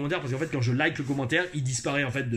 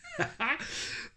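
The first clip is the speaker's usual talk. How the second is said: high, quiet laugh; brief amused high-pitched laugh; 10 semitones higher, 8 dB quieter than usual. Real, not acted. Laughs at the message. (rire aigu)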